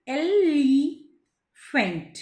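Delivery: एलिफेंट slow as el, long ee, pause, fent; drawn-out एलिफेंट with E set apart; separate E. Shown as a demonstration. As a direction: sound out एलिफेंट in three parts. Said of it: "इस ई को हम अलग से बोलेंगे".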